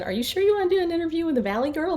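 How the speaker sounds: high, fast, sarcastic jab; sarcastic mock. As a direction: throw the question back at her in a mocking tone. Snapping back at rude guest.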